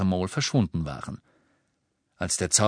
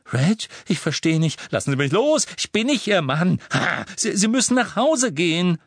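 panicked; 9 semitones higher, 5 dB louder than usual. Acted. Mrs Cattermole struggles with Ron.

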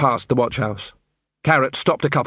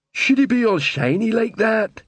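high-pitched, puzzled troll voice; 10 semitones higher than usual. Acted. Detritus looking at sickly Dibbler, puzzled troll. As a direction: ask as a baffled troll.

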